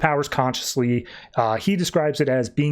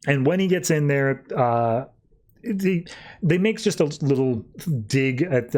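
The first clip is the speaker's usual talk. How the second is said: usual speaking voice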